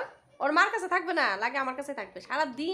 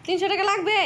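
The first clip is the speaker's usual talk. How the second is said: high, loud disbelieving question; surprised; 8 semitones higher, 9 dB louder than usual. Real, not acted. Wife repeats husband's price back, high and disbelieving. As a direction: feign disbelief at the sum.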